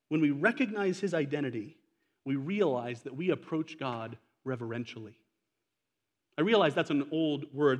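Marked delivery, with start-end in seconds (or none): 0.1-5.2 hushed and reverent. Real, not acted. Reverent softening.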